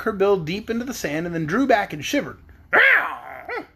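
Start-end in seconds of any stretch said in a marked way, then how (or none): 2.7-3.6 high hen yelp; sudden high squawk of pain; pained cry. A narrator's reading. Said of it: "she cried".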